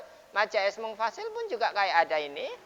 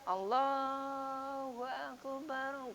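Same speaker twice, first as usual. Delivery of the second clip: very slow, soft, drawn-out Allahu Akbar; gentle; 6 semitones higher, 8 dB quieter, much slower than usual. Shown as a demonstration. Teacher demonstrating a gentle, soft adhan. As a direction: let it flow softly and slowly. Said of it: "Suaranya lembat lembut ya".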